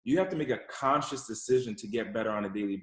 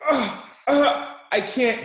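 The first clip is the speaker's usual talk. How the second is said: high, loud grunts and protest; child's tantrum; 12 semitones higher, 6 dB louder, much slower than usual. Retold or imitated. Kid turning to buckle seatbelt, frustrated tantrum. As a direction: imitate a kid straining into a fit. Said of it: "Then he throws a fit".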